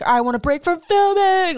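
imitating him freaking out, a touch frantic. Imitating Tom panicking to the producer, freaking out. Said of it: "like, freaking out".

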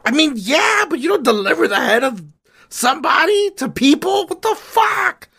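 high, shouted disgust at Ptolemy; outraged Caesar. Acted. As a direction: yell at him like a furious general.

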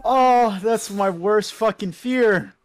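ordinary talk, nothing marked

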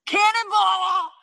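loud, slow, high cannonball yell. Real, not acted. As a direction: belt it out as you jump. Jumping into pool on camera.

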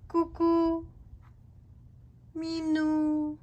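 high, loud call for the cat. Real, not acted. Calling the cat again.

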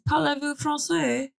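mock French classroom line; French accent. Host showing off her only French, light French accent. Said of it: "The only thing I remember is".

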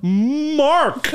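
mock animal cry; dog cow call. Shown as a demonstration. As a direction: bark it like a moo. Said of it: "that's the sound it makes".

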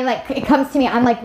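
ordinary talk

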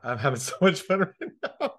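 real giggles breaking through the words; laughing. Real, not acted. Giggling while coaching excites her.

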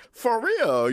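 high laugh then for real. Real, not acted.

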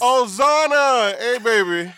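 big, high, drawn-out fatherly greeting; joyful greeting. Acted. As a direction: greet your daughter loudly and delightedly. Father greets his daughter joyfully.

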